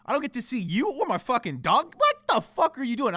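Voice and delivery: loud, high, indignant rant; re-enacted furious complaint; angry outburst. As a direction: blow up at being left without the dog. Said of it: "I get, I'm, I get angry".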